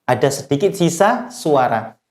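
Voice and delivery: ordinary delivery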